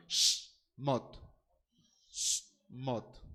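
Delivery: "Shmot" with a long hushed Sh, then mot; "Shmot" with no vowel after the Sh; correct Hebrew pronunciation. Shown as a demonstration. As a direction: split "Shmot" into a drawn Sh and mot. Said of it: "se pronunciaría como Shmot".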